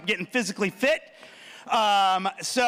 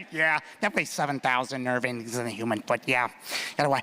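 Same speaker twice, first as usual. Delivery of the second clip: masseuse again; 8 semitones lower, 3 dB quieter than usual. Retold or imitated. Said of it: "This guy".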